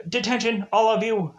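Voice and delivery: stiff robotic principal voice. Retold or imitated. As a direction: announce it like a robot. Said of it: "Not in that very robotic voice".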